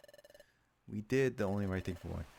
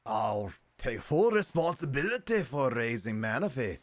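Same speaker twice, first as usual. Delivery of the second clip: loud, slow, hammed-up Scottish Walrein; bad Scottish accent; 5 semitones higher, 8 dB louder, slower than usual. Walrein vows to raise Manaphy, in a bad Scottish accent. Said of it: "try to give him a bad Scottish accent".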